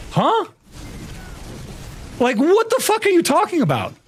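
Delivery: very high squeal of disbelief; exaggerated high-pitched bafflement; incredulous. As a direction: pitch shoots up, utterly baffled. Retold disbelief at officer's question.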